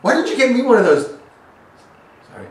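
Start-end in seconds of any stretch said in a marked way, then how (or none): 0.0-1.2 loud high mock-jealous whine; mock jealous shout. Real, not acted. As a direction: demand it in playful envy. Jokey jealous outburst.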